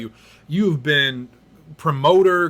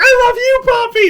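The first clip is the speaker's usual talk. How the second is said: very high, loud fan scream; screaming fanboy; 10 dB louder than usual. Retold or imitated. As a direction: yell it back like a fourteen-year-old girl. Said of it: "And I was like".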